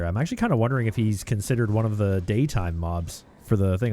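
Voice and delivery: ordinary talk, nothing marked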